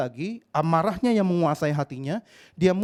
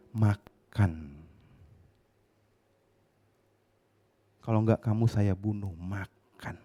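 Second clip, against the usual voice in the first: low, menacing order; cold command; 9 semitones lower, 3 dB quieter, slower than usual. Retold or imitated. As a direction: threaten him quietly and deep. Father orders son to eat ear.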